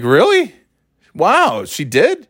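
high, astonished questions; surprised. Retold or imitated. Told the lady paid for his meal, high surprise.

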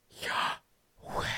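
whispered in-and-out breath sound, "yah... weh"; soft inhale, then exhale as Yahweh; breathed name. Shown as a demonstration. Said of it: "every time they breathe".

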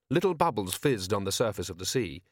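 ordinary delivery

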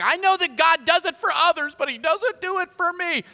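high-pitched imitation of a doubting believer; mock complaint.

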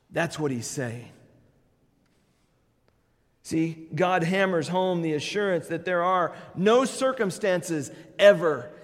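ordinary talk

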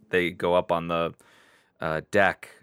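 ordinary delivery

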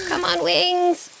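high, drawn-out straining urge; gritted teeth. A narrator's reading. Dragonfly urging wet wings through the downpour, straining. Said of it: "She gritted her teeth".

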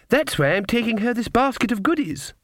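put-on high-pitched child voice; little girl. A narrator's reading. Girl explains her basket to the witch, childlike. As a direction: answer brightly as a small child.

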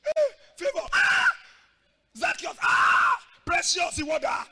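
high, loud calling out; shouted names. Retold or imitated. Preacher calling out church members as deserters, shouting. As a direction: yell names across the crowd.

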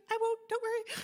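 high, meek promise; timid reassurance. Retold or imitated. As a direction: squeak it out to calm a big animal. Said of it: "And I'm like".

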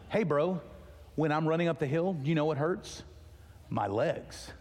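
usual speaking voice

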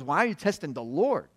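ordinary talk

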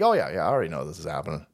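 bored audience imitation. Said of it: "everybody in the audience is like".

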